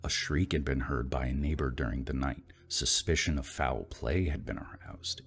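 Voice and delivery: plain reading voice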